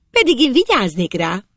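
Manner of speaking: high-voiced girl's needling; teasing girl. A narrator's reading. Girl teases the boy from below.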